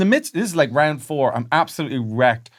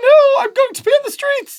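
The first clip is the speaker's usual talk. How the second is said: very high, louder mock lady voice; squeaky posh parody; 9 dB louder than usual. Friends riffing on his posh voice, squeaky and high. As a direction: camp it up as a prim lady.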